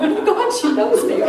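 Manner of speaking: high, giddy burst of excitement; starstruck squeal. Retold or imitated. Young fan thrilled her idol knows her, starstruck squeal.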